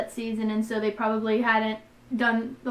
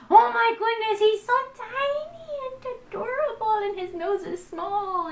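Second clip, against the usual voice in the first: gushing; 10 semitones higher than usual. Real, not acted. Gushing over tiny Henry.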